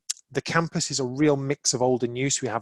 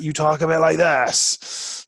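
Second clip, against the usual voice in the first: hammed-up pirate, louder and slow, drawn out; 4 semitones higher, 7 dB louder, slower than usual. Shown as a demonstration. Speaker showing how pirates talk, slow loud hammed-up pirate voice. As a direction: growl it like a jolly stage pirate. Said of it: "if you're a pirate".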